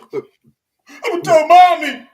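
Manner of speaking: put-on high, slow whiny child voice; pouting little kid threatening to tell. Kid whining to threaten telling.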